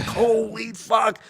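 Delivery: high, drawn-out awe; acted amazement. Comic reliving the end of the phone call, high drawn-out amazement. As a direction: stretch the words out in stunned disbelief. Retells his amazed reaction.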